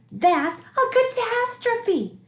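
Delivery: put-on high, slow, woeful little boy; dramatic child. A narrator's reading. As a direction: wail it like the world is ending. Child despairs over losing toys.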